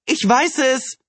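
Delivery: high-pitched triumphant cry; excited calling out. A narrator's reading. Neighbour waving a newspaper in the driveway, excitedly calling. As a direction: call it up from the street, excited. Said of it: "rief die Nachbarin".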